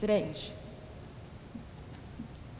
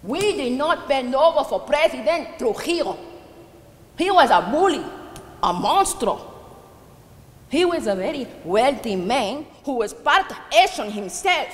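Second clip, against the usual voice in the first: raised, higher voice, hard emphasis; angry denunciation; 4 semitones higher, 4 dB louder than usual. Acted. Old widow condemning President Trujillo to her neighbour, angry. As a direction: denounce him with rising outrage. Denounces the dictator behind the massacre.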